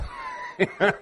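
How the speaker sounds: bursting high-pitched laugh. Real, not acted. Preacher laughing at his old uncle's joke, high burst.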